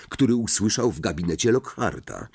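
ordinary delivery

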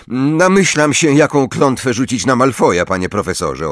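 high, angry, defiant retort. A narrator's reading. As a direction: answer back in anger. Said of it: "odrzekł Harry ze złością".

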